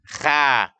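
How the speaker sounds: KHA light with open "a"; wrong thin kha. Shown as a demonstration. Teacher lists readings to avoid, thin kha. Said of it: "Tidak dibaca".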